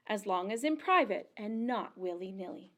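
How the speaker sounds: plain reading voice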